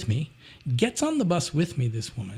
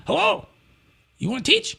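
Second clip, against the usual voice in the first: high, clipped-sounding caller voice, lightly done; caller's voice; 11 semitones higher than usual. Retold or imitated. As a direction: imitate the eager caller on the phone. Imitating Chinese caller.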